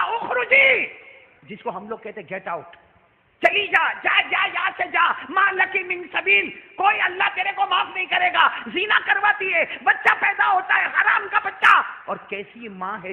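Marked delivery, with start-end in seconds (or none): 0.0-0.9 furious Arabic command, much higher and louder; angry shout. Acted. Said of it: "غصے میں کھڑے ہوئے اور کہا". 3.5-11.8 enraged yelling, much higher and louder; furious shout. Acted. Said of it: "غصے میں کھڑے ہوئے".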